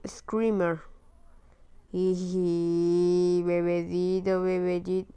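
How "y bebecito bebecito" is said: put-on slow drawn-out baby talk; cooing to baby. Gamer coos slowly at the baby in his care. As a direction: stretch it out sweetly like lulling an infant.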